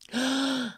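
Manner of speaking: high startled gasp; mock sharp intake of breath. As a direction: gasp in shock. Reaction to honey question.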